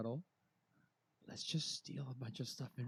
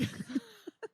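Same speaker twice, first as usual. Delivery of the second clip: squealing nervous laugh; high-pitched laugh. Real, not acted. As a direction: let out a shrill laugh. Laugh after panicked cry.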